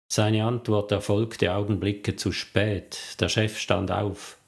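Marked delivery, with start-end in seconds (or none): none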